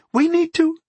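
high-pitched daughter's protest; shocked daughter. A narrator's reading. Daughter objects to a surprise suitor, high-pitched. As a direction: appeal to father in a high voice. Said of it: "Fallowen began".

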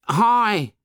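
put-on high, calling boy's voice. A narrator's reading. A boy calls to a stranger through the jungle, high and eager. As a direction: shout for attention like a small boy. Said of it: "it said".

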